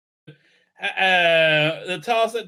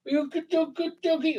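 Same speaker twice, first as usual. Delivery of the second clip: doting pet voice; 11 semitones higher, 4 dB quieter than usual. Real, not acted. Presenter praising the dog, high doting baby talk.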